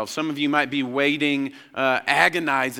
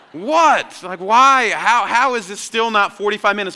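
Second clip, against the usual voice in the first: high, loud cries of disbelief; hammed-up exasperation, pitched high; outraged kid; 7 semitones higher, 6 dB louder than usual. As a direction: protest like a wronged child. Acting his outraged childhood reaction to waiting.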